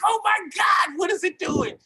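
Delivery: high, loud panicked shout; retold panic over the ads spending money; panicked cry. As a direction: shriek it like the money is vanishing. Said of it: "I was like".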